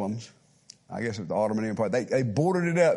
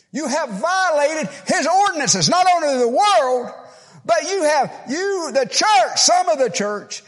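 high, loud pulpit preaching; fiery preaching; 13 semitones higher, 7 dB louder than usual. Real, not acted. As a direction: thunder the sermon at the congregation. Preaching at full force.

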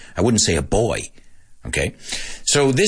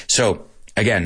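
ordinary delivery

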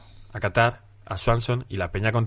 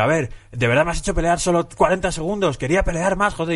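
raised pitch, half-acted complaint, lightly voiced; mock-indignant Renato Moicano; 8 semitones higher than usual. Retelling Moicano complaining to his opponent after a quick win, mock indignant. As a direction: whine about a fight that was over too fast. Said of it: "Y le dice algo así como".

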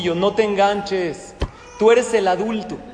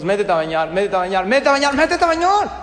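escalating nagging; 3 dB louder, faster than usual. Acted. Parent losing it over bath time, escalating to a yell. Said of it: "lo que pasa es que cuando decimos".

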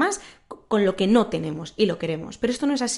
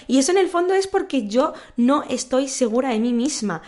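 ordinary talk

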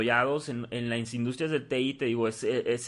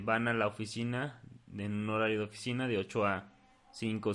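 usual speaking voice

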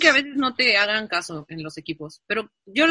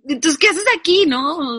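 indignant retort; 7 semitones higher, 5 dB louder, slower than usual. Retold or imitated. Retelling her indignant comeback.

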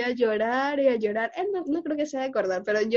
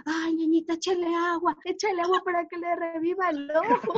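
mock sweet teasing; 5 semitones higher than usual. Teasing crying little sister.

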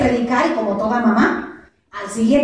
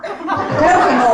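slow, dry, laughing reply; husband's slow answer; 4 semitones higher, 4 dB louder, much slower than usual. Retold or imitated. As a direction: drag it out with a chuckle. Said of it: "Mi amor". (respuesta lenta y divertida del esposo)

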